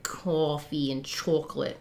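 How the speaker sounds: low, soft imitation of New York vowels on "coffee and chocolate"; New York accent. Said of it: "They say, like".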